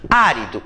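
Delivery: "árido" stressed on the first syllable; accented first syllable. Shown as a demonstration. Teacher shows students the word with its accent, stressed on the first syllable. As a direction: say "árido" with the beat on Á. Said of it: "Se eu tiro o acento".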